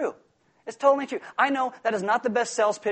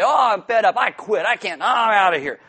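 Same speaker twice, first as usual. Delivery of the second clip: loud, exasperated quitting outburst; hammed-up I'm done, storming off; mock quitting rant; 2 semitones higher, 7 dB louder than usual. Preacher voicing Joseph as a fed-up slave, loud mock rant.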